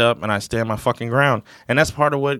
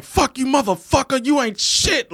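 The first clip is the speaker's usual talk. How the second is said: mock gamer yelling; 11 semitones higher than usual. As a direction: act out yelling at a video game. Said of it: "like, just yelling".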